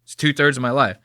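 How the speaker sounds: ordinary delivery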